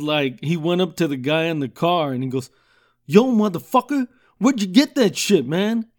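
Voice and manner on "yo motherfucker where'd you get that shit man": loud, high furious yell at the driver; angry yell. Retold or imitated. Man confronting a driver, shouting angrily. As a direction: bark it out like you caught a thief. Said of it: "He was pissed off".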